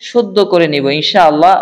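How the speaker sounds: ordinary talk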